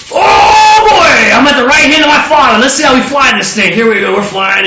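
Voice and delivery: high whooping shout, settling as it goes; acted elation. Son at his father's right hand, hammed-up elation. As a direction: burst out like a thrilled kid, then ease down.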